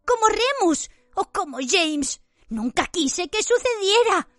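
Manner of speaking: high, cringing, frightened whine; terrified Pettigrew voice. A narrator's reading. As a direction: plead in a high panicked voice.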